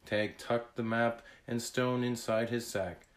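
plain reading voice

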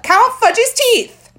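put-on drawn-out childish insisting; insistent toddler demand. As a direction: stretch it out like a bossy toddler. Fudge insisting louder.

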